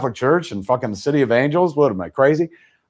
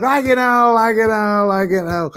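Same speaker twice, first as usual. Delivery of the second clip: high catchphrase loop, sinking in pitch; wound-up squeal easing off step by step; winding down; 9 semitones higher than usual. Retold or imitated. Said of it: "he would wind down and then wind up".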